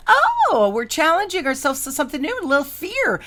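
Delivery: high, sing-out teasing of a friend; mock teasing. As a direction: needle them with a high, exaggerated "oh". Friend teases her about fear.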